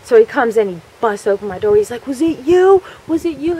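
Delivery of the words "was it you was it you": high-pitched, insistent mock interrogation; acted accusation. Charlie bursting in on the culprit, acted accusation. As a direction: imitate an angry kid demanding a culprit.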